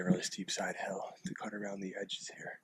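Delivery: hushed hunter whisper. Real, not acted. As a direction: whisper so the deer can't hear. Whispering while stalking deer.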